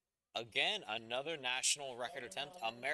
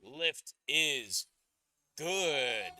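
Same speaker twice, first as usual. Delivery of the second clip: slow, louder drawn-out ring announcer call; 4 semitones higher, 6 dB louder, much slower than usual. Acted. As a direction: stretch it out like a big arena announcement. Drawn-out announcer call of good lift.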